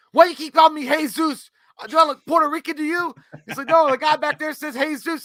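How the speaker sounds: high, loud annoyed retort; irritated retort. Retold or imitated. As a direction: snap back at the mistake. Zeus character confronting.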